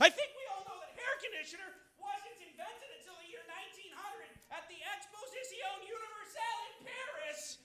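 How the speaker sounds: very high and very soft; tiny high voice. Acted. Comic correcting an imagined audience member, tiny high voice. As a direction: squeak it out faintly, far above normal pitch. Mock outburst answering imagined heckler.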